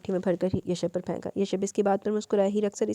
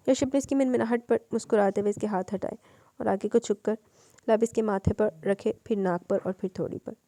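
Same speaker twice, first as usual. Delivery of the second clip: plain reading voice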